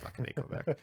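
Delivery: breathy, unvoiced mock grumble; whispered aside. A muttered joke aside about Quebec, whispered. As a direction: hiss it under your breath.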